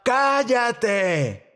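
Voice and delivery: high, drawn-out telling-off; mock yell. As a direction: act out a crowd silencing someone. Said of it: "la multitud le gritaba".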